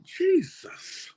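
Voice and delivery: high, breathless "Jesus"; dismayed "Jesus" at the failing grade; shocked gasp. Retold or imitated. Shocked at the marked paper.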